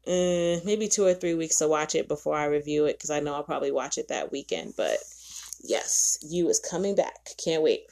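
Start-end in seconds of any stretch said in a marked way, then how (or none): none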